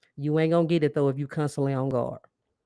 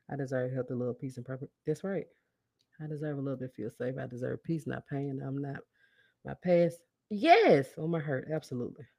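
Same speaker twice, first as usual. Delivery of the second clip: soft murmured affirmations; 2 semitones lower, 7 dB quieter than usual. Real, not acted. Repeating affirmations along quietly.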